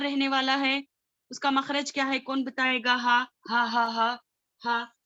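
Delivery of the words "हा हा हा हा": normal talking voice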